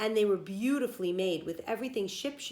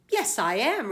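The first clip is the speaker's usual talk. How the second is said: indignant, louder retort; angrily; 4 semitones higher, 7 dB louder than usual. A narrator's reading. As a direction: snap back, offended. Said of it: "replied Stewart angrily".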